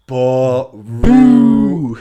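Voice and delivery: loud, hugely stretched "brutal"; drawn-out brutal. Real, not acted. Co-host judging Ramsey's speech, loud drawn-out mockery. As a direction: bellow the word and drag out each syllable. Mocking how bad the speech was.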